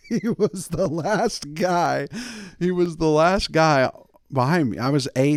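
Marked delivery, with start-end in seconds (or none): 0.1-3.9 mock dramatic. Real, not acted. Dramatic repeat of punchline.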